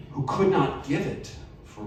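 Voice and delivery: ordinary delivery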